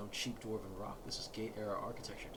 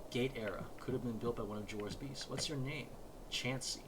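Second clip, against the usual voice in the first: ordinary delivery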